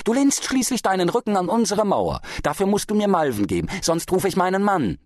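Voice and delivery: high, insistent threat; threatening. A narrator's reading. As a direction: press the demand with a warning. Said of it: "drohte sie".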